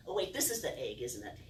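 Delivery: ordinary delivery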